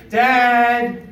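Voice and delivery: put-on high, called-out little girl's voice from upstairs; little girl calling. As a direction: call up high like a small child from her bed.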